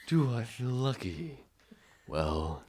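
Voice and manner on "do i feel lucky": lightly voiced movie tough guy, slow; Dirty Harry quote. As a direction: drag out the famous line. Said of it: "You gotta ask yourself".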